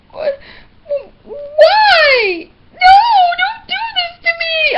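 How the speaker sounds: high, loud hammed-up pleading wail; mock the ex as a sobbing, begging baby; mock whiny begging. Ex begs after breakup.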